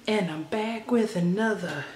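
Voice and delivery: echoey bare room sound; hallway echo. Real, not acted. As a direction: voice bouncing around an empty room. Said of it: "there's an echo in here".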